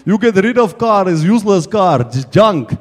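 imitated Russian-accented rant; Russian-accented mechanic. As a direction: play a Russian mechanic dismissing a car. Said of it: "He's got a big Russian voice".

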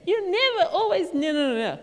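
high, loud accusing outburst. Shown as a demonstration. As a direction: fling the blame at him, loud and high. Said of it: "Instead of saying".

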